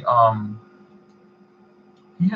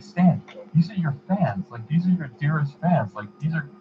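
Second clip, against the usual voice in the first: higher-pitched retold plea; mildly imploring, pitched up; earnest protest; 6 semitones higher than usual. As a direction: protest as if reasoning with him. Retold pleading with Stan.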